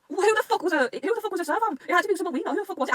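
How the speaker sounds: high-pitched, insistent questioning; baffled outburst. Real, not acted. Fan puzzling over who the servant was, baffled. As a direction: demand the answer, pitched well up.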